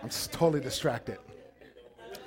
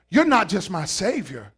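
ordinary talk

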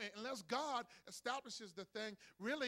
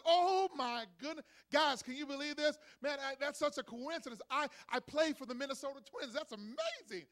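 higher, loud, thrilled exclaiming; excited father; 5 semitones higher, 7 dB louder than usual. Acted. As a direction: gush like a stunned new dad. Twins player hears news.

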